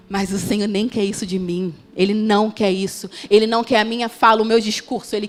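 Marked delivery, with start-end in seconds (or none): none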